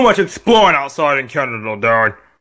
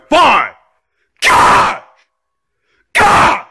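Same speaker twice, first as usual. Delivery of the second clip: grudging yell into screams; screaming; 9 semitones higher, 4 dB louder, much slower than usual. Acted. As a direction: give in, then scream in frustration. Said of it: "stop screaming".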